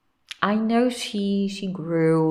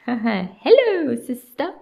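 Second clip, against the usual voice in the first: mock Cockney accent; 6 semitones higher, 6 dB louder, slower than usual. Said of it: "giving her that accent".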